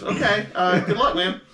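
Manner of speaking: high, uneasy farewell; a clerk trying to hide his nerves; edgy, a bit too loud goodbye; nervous. Acted. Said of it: "he looks a little nervous".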